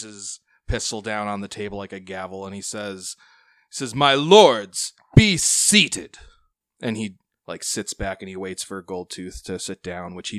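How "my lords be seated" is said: high, loud order to sit; commanding bellow. Acted. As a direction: boom over the noisy council. Pirate lord gavels the council.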